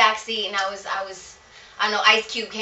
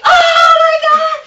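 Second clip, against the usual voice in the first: much higher, louder, slow amazed shout; 13 dB louder, much slower than usual. Retold or imitated. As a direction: shout it out, blown away by the hook. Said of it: "And he was like".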